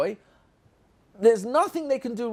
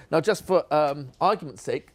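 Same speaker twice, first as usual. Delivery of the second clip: ordinary talk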